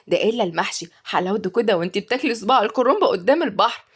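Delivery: ordinary delivery, nothing marked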